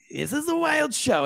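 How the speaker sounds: high, slow hammed-up showman voice; mock showman voice. To the co-host, echoing "this is wild" in a hammed-up showman voice. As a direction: ham it up like a game-show host.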